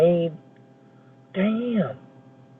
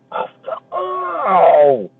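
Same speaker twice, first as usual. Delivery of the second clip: sudden high cry of pain; 7 semitones higher, much slower than usual. Real, not acted. Hit in the face by a snowball, real pained cry. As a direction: yelp as if struck in the face.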